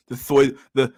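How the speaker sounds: normal talking voice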